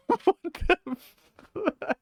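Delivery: high-pitched giggling curse; laughing. Real, not acted. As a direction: squeal it through real laughter. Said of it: "I don't know why I'm laughing".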